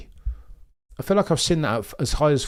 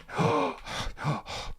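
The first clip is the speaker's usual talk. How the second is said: long airy gasp before the start; unvoiced gasp of nerves; breathy nervous gasp; 6 semitones higher, 10 dB quieter than usual. Real, not acted. Race about to start.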